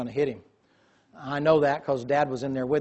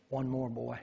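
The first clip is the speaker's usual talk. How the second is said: soft command; 8 dB quieter than usual. Retold or imitated. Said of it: "He said".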